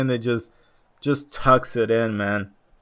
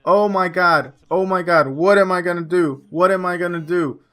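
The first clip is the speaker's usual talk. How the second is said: higher, hammed-up panic, the line said twice; mock panic; 5 semitones higher than usual. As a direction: act out a flustered manager in a rush. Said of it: "I'm like".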